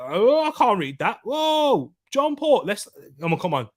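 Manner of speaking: usual speaking voice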